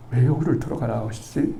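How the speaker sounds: ordinary talk, nothing marked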